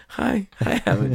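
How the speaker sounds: high, giddy starstruck fanboy hi; mocking himself starstruck. Mocking his own starstruck hello to Howard, high and giddy. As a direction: squeak out a fanboy greeting. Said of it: "Just like, he's like".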